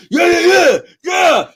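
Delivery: mock cocky podcaster. Streamer mimicking self-impressed MMA podcasters, mock cocky bluster. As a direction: imitate someone really feeling himself, loudly. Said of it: "with bass on their voice".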